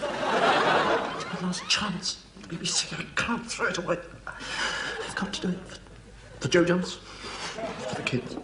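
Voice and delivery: high, trembling half-whisper, slow; desperate resolve. Acted. Announcer steeling himself to do the job for his family, desperate. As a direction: force the words out through panic.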